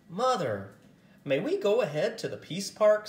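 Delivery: put-on high, bright-eyed child's plea; eager child asking. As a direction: ask mother eagerly like a young girl. Child asks mother, eager.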